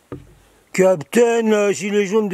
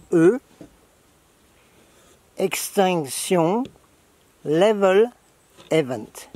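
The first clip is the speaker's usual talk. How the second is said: slow spelling out; slower than usual. Shown as a demonstration. Spelling out acronym slowly.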